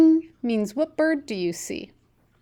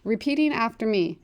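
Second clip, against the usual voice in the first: ordinary delivery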